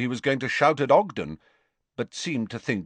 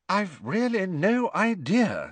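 higher, slow, puzzled official; lightly voiced official; 9 semitones higher than usual. Official replying about the ring, bemused. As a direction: answer slowly, pitched up.

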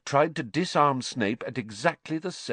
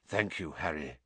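quiet thanks; 6 semitones lower, 9 dB quieter than usual. Acted.

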